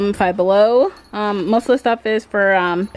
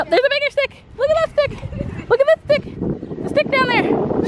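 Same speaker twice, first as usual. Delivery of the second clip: much higher, sing-song voice for a puppy; 13 semitones higher than usual. Real, not acted. To Lulu the puppy on a walk, high sing-song baby talk.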